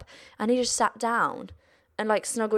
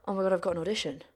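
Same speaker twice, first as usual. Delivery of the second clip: deflated; 5 dB quieter, much faster than usual. Retold or imitated. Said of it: "then you get".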